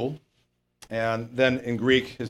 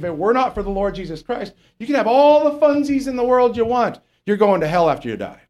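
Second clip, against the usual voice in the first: preaching; 7 semitones higher, 4 dB louder than usual. Acted. Preaching warning about hell.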